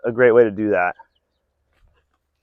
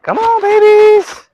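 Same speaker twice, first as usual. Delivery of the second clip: high, slow coaxing call; chicken calling voice; 6 dB louder, slower than usual. Real, not acted. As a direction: coax the hens over, drawn out up high.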